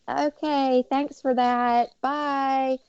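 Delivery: high, slow, airy mock farewell; breezy brush-off. Student to school president, a high, slow, breezy brush-off. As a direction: toss off the goodbye like it's nothing. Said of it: "I was like".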